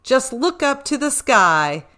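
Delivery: ordinary delivery, nothing marked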